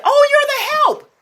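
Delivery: put-on high, slow sweet old lady; old lady's voice. Retelling the patient's line, in a high old-lady voice. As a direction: mimic a ninety-year-old patient sweetly. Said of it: "And she said".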